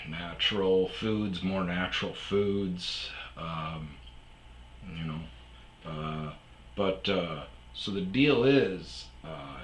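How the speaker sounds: normal talking voice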